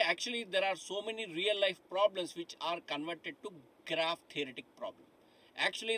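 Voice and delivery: normal talking voice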